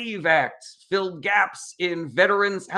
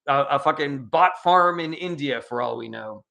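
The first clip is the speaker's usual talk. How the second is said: ordinary talk, nothing marked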